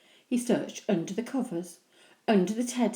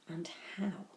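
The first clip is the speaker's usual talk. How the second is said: whisper; 10 dB quieter than usual. A narrator's reading. Narrator whispers Henry's scheme.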